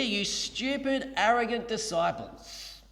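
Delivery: slow, drawn-out mock scolding; a hint of a scornful rebuke; mock put-down. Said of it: "expect Jesus to say something similar".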